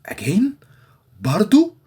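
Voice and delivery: incredulous again. Retold or imitated. To a friend who repeated his mistake, incredulous. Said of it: "So you say".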